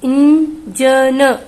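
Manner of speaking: 'Engine' slow, with a clear n sound and a trailing vowel; slow syllable reading. Shown as a demonstration. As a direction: sound out engine slowly, stressing the n. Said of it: "न का sound निकलेगा".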